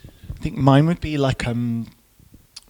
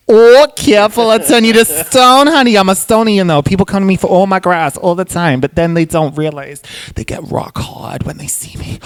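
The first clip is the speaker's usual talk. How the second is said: loud, high Bronx character voice, easing toward the end; Bronx Medusa; 6 semitones higher, 7 dB louder than usual. Acted. Medusa from the Bronx warning her customers, loud and brassy. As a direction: start brassy and high, then settle into Bronx patter. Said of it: "I'm gonna do Medusa, but she's from, like, the Bronx".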